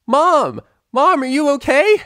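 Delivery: high, loud worried call down the hall. Acted. Daughter calls to her mother after the trap, high loud worried. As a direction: shout for mom in alarm. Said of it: "a voice behind them called out".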